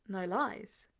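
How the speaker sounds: ordinary delivery, nothing marked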